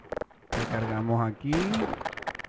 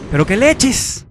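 higher, louder what-the; stunned outburst; 9 semitones higher, 12 dB louder, slower than usual. Real, not acted. Gamer shouting in surprise at the screen. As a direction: blurt out disbelief.

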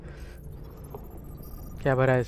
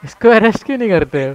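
louder and higher, exasperated; frustrated; 5 semitones higher, 6 dB louder than usual. Real, not acted. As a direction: demand why nobody arrests him.

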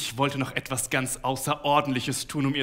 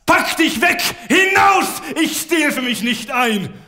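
much higher, much louder yelling, slowing at the end; repeated furious shouts; 13 semitones higher, 11 dB louder, slower than usual. A narrator's reading. Husband smashing things and yelling at his wife, furious shouts. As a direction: throw the words at her like the objects. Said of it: "Dabei schrie ich immer wieder".

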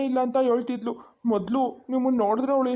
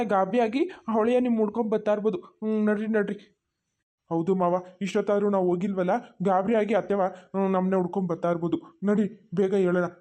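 ordinary talk, nothing marked

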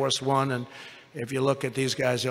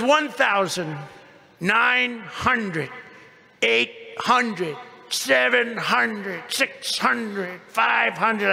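imitating altitude callout; 5 semitones higher, much slower than usual. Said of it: "So it goes".

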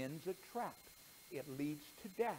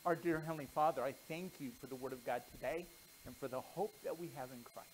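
prayer; 4 semitones lower, faster than usual. Real, not acted. Closing prayer.

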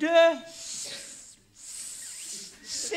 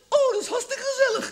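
mother's voice; 13 semitones higher than usual. Retold or imitated. Mother reacting to the party plan.

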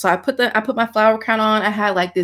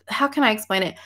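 normal talking voice

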